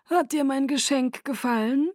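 courtly Aro. Acted. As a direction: flatter her with lofty charm. Aro's voice.

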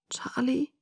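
tiny, high, whispery question; very soft and small, lifting at the end; strangely small voice. A narrator's reading. Found girl to her father, strangely small voice. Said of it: "Meine Stimme klang merkwürdig klein".

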